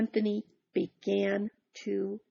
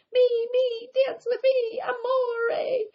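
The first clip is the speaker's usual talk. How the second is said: much higher, loud chasing cries; ladies crying out; 4 dB louder, slower than usual. Acted. Ladies chasing Big Anthony, much higher loud cries. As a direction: squeal after him like lovesick women. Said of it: "cried the ladies".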